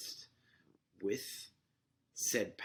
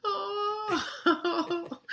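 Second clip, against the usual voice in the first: sudden loud high-pitched cry of surprise; real shock then laughter; 12 dB louder than usual. Real, not acted. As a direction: gasp out a stunned oh. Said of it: "And I was like".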